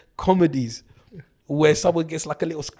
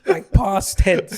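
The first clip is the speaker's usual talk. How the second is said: slow, higher, through laughter; laughing emphasis; 7 semitones higher, 7 dB louder, much slower than usual. Real, not acted. Teasing his friend about dying, slow and laughing. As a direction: stretch it out while cracking up. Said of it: "joking aside about tense".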